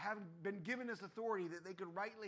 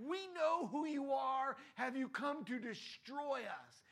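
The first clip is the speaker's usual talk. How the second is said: mock demon voice; 6 semitones higher, 6 dB louder, slower than usual. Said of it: "So they like".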